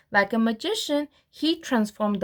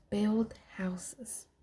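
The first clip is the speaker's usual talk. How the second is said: whispered ending; 9 dB quieter than usual. Real, not acted. Soft aside at sentence end.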